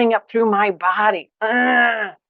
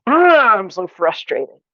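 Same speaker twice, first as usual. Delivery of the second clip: frustrated outburst; 5 dB louder, slower than usual. Shown as a demonstration. Teacher voicing the inner self: frustrated outburst. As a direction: burst out, then deflate. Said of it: "you might just ask yourself".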